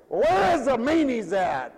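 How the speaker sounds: loud, high, drawn-out complaint; hammed-up annoyed call; retold mad demand. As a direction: holler it like an irritated shopper. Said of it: "So I was mad".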